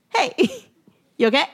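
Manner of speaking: put-on high, quick, sweet old lady voice; kindly old lady voice. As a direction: voice a concerned elderly woman. Old lady checking on her.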